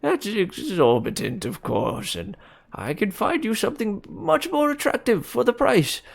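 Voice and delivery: put-on high, pleading old man. A narrator's reading. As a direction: beg them to take another piece. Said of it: "with a manner of pleading".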